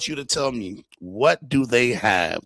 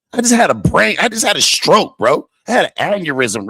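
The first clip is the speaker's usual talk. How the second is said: mock outrage; 8 semitones higher, 8 dB louder than usual. Real, not acted. Mock stroke reaction.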